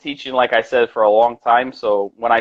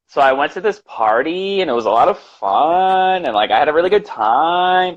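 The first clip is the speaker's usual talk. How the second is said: mock California accent; 4 semitones higher than usual. Shown as a demonstration. New Yorker making fun of Californians, mock California accent. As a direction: exaggerate a higher, questioning California lilt. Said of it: "That's the California accent".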